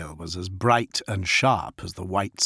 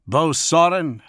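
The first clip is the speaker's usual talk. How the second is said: higher, stern wolf chief; Fafnir growling a rebuke; 8 semitones higher, 6 dB louder than usual. Acted. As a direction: command the packmate to apologise.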